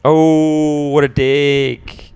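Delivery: drawn-out disgust. Real, not acted. Reacting to a message.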